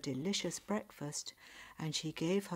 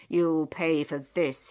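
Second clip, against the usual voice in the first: loud, slow threat; acted anger, slow and forceful; angry threat; 10 dB louder than usual. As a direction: threaten revenge, each word weighted. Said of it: "He was very angry".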